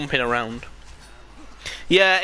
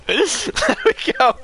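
squeaked out high while laughing; slow, high words broken by laughter; helpless laughter; much slower than usual. Real, not acted. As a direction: barely get it out for laughing. Laughing hard after game mishap.

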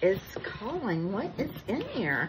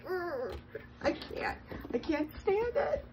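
soft, half-whispered can't stand it; mock-overwhelmed, too cute to bear; overwhelmed squeal; 9 semitones higher, 5 dB quieter than usual. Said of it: "I'm just like".

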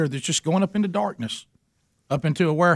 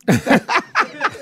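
high laugh; 11 semitones higher, 3 dB louder than usual. Real, not acted. Teller laughs at his story.

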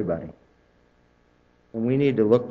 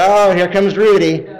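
mock dread; 6 semitones higher, 9 dB louder than usual. Relatives dreading him at Thanksgiving.

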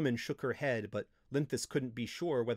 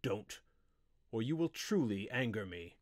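cold, emotionless. A narrator's reading. Wizard warning his apprentice, coldly. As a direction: warn without any feeling. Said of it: "voice was cold, emotionless".

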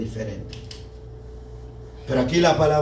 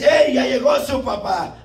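higher, louder mock yell of "¡Ey, ya llegó su papá!"; mock yell at kids; 6 semitones higher, 4 dB louder than usual. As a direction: bark it like a strict parent calling the kids. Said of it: "necesitas ir a gritarles".

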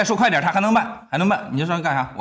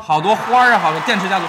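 excited thanks; 4 semitones higher, 8 dB louder than usual. Real, not acted. Hyping a gift on stream.

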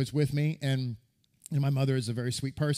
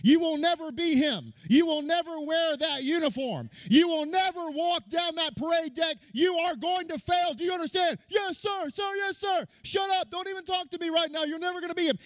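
high, barking drill sergeant yell at recruits; screaming drill sergeant; drill instructor yelling. Retold or imitated. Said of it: "he told the platoon".

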